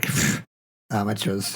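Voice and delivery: low, soft scoff; dismissive German regiments. Acted. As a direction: puff it off with mock contempt. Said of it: "the German regiments are like".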